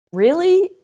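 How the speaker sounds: high, loud-ish questioning squeal of disbelief; incredulous. Retold or imitated. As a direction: retell your baffled reaction, voice shooting right up.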